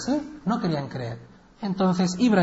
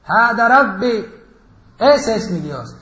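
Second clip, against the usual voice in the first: louder, slow, awed declaration; solemn proclamation; 4 semitones higher, 8 dB louder, slower than usual. Acted. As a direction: proclaim it to the crowd with wonder. Ibrahim proclama la estrella. (proclamación solemne y fuerte)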